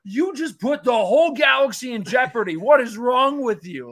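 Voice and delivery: high, loud disbelieving rant; exasperated scolding. Retold or imitated. Speaker berating Sabine for endangering the galaxy, high and loud. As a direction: scold her like a reckless kid.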